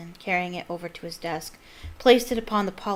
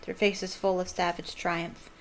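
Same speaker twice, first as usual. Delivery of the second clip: plain reading voice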